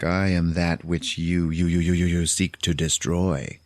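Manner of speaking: lightly voiced glitching machine; glitchy computer stutter. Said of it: "his voice is all glitchy, like he stutters, but like a computer stutter".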